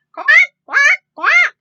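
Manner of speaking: loud, high squeaky quacks; ducklings quacking. Acted. Ducklings quack back at the cars, loud and high. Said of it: "went Jack, Cack, Lack".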